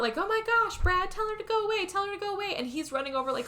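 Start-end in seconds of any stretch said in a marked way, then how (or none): 0.2-2.5 high-pitched retold panic; pleading in an acted-out panic; freaking out. Frightened wife begging her husband to send someone away, retold freak-out. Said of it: "she's freaking out".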